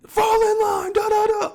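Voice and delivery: high, loud barked command; mock drill sergeant. Drill team instructor barked orders.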